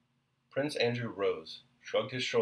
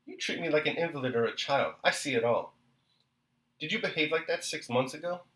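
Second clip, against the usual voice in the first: hurried reproach; 6 semitones higher, 4 dB louder than usual. Acted. Wife hurriedly reproaching husband.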